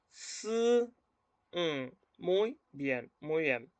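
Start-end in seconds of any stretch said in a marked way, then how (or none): 0.1-0.5 "S" as a long soft hiss of air; blown S. Shown as a demonstration. Teacher repeating for learners, blown "S". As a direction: blow softly through "S".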